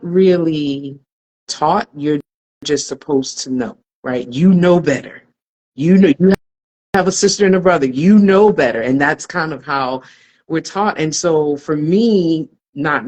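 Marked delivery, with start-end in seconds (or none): 4.3-7.6 raised, firm "You know better" like a parent lecturing; a hint of a stern parent telling the oldest child "You know better"; scolding parent. 7.9-8.8 raised, firm "you know better" like a parent lecturing; a hint of a stern parent telling the oldest child "you know better"; scolding parent.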